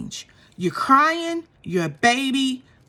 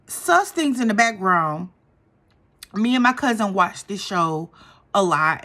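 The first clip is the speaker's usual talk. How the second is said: ordinary delivery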